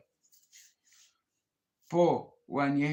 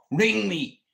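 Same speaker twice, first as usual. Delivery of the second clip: insistent call out; 5 semitones higher, 6 dB louder than usual. Real, not acted. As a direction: ring me, said louder and higher, insisting. Pleading repeated offer to call.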